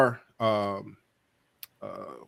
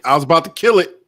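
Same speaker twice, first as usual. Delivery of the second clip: raised voice, pitch a little up; louder exclamation; 5 semitones higher, 9 dB louder, faster than usual. Real, not acted. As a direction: blurt it out with sudden force. Repeats line, louder first take.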